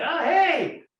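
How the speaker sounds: loud, high startled hello; caught off guard. Retold or imitated. Worker caught eating a chicken biscuit, startled loud and high. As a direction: sound caught with food in hand.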